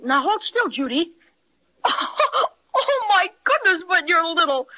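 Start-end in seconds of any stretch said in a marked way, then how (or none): 1.8-2.5 high burst of laughter at a tiny sister; amazed laugh. Acted. Boy laughing at his suddenly tiny sister. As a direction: laugh in delighted surprise. Jimmy sees tiny Judy.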